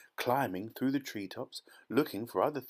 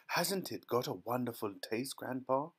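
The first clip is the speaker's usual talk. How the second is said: usual speaking voice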